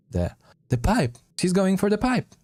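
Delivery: high-pitched alarmed cry; urgent warning. Acted. Companion warning that assassin is fleeing, urgent high cry. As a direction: call out the escape urgently.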